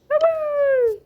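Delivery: very high squeaky bye-bye, falling; squeaky goodbye. Pretend. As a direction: squeak it out like a toy. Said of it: "squeaky goodbye voice".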